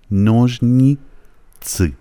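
slow نوژنی, break, thick Azeri-like تسی; two parts, thick i. Shown as a demonstration. Teacher modelling the Azeri-like i, نوژنیتسی split slowly. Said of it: "ای دوم رو غلیظ بگید".

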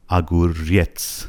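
slow اگوریتس, stress held on the final ریتس; stress on last syllable. Shown as a demonstration. Teacher showing where stress falls, slow اگوریتس stressed at the end. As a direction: break اگوریتس up, leaning on ریتس. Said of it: "فشارش روی رتس هستش".

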